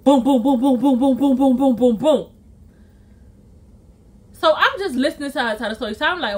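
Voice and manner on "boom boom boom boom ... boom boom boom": rapid, even booms imitating a racing heart; pounding heartbeat noise. Friend's heart started racing, imitated thumping. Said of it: "Her heart started going like".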